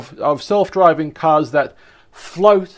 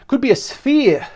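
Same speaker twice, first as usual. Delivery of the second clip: higher-pitched mock German accent; angry German; 7 semitones higher than usual. Presenter rereading the article to viewers, angry German. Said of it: "Sound like an angry German".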